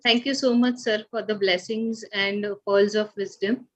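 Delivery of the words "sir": ordinary talk, nothing marked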